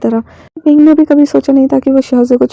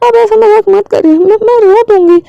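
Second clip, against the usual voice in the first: put-on high-pitched pleading little girl; distressed child; 8 semitones higher, 4 dB louder than usual. Child begging her guardian to stop joking, upset. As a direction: beg in a high child's voice, near tears. Child begs, threatens to cry.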